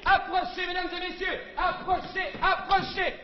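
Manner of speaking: high fairground barker call. Acted. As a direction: call the crowd like a showman. Barker calling crowd again.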